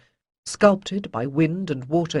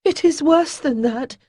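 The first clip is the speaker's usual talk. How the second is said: put-on high, nervous young voice, louder; young, exclaiming; 11 semitones higher, 5 dB louder than usual. A narrator's reading. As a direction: blurt it out with nervous energy. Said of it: "young Selast exclaimed".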